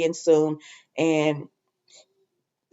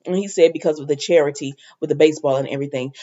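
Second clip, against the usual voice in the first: usual speaking voice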